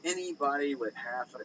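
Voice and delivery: ordinary talk, nothing marked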